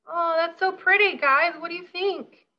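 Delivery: high, loud gush over it; thrilled with how it looks; excited delight. Real, not acted. Admiring the colourful board, gushing high and loud to the guests.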